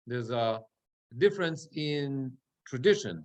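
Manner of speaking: normal talking voice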